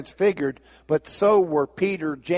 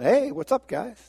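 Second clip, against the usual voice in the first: breezy Thomas; 9 semitones higher than usual. Acted. Thomas arrives casually, unaware.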